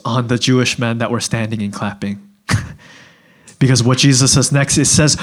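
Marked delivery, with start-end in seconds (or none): none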